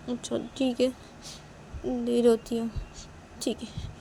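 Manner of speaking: small, high tearful voice; tearful Manisha. A narrator's reading. Crying girl agrees to stop, tearful giving-in. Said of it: "अपने आँखों से आंसू को साफ कर लेती है".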